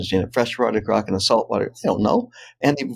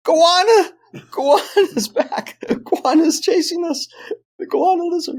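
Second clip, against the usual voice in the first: put-on very high child's cry of alarm; high, drawn-out mock shriek; screaming daughter impression. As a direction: imitate a panicked little girl, pitched way up.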